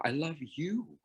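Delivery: soft with you leaned on; key the word you gently; quiet emphasis on the final you; soft stress on you. Shown as a demonstration. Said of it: "Not Joe".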